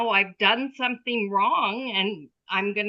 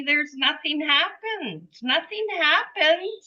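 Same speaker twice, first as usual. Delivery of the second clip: higher-pitched voicing of an innocent dog; imagined dog's voice; 8 semitones higher than usual. Dog telling owner all is forgotten, higher imagined dog voice. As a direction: lift the pitch to speak for the dog. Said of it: "it's like he's saying to me".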